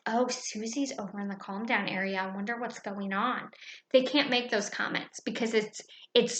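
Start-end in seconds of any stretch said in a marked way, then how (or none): 0.1-3.5 much softer, hushed mock gossip; lightly voiced nosy child whispering about Susie; gossiping classmate. Student gossiping about a classmate, softly imitated. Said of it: "they cannot make comments".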